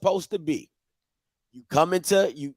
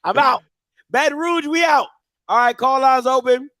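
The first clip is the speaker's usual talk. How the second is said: excited; 9 semitones higher, 6 dB louder than usual. Real, not acted. Playful exit, hyped.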